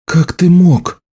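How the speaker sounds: high-pitched, indignant outburst; appalled reproach. A narrator's reading. Healer accuses the marked man of killing, high appalled cry.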